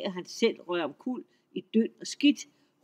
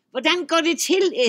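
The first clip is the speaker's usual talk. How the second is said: troll shriek; 8 semitones higher, 8 dB louder than usual. A narrator's reading. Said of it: "Trolden skreg op".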